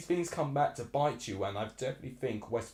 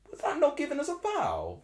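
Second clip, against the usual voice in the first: much higher pitched, stunned question; incredulous; 4 dB louder than usual. Real, not acted. Streamer can't believe the referee missed the foul, asking in high-pitched disbelief. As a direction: ask it in sheer disbelief.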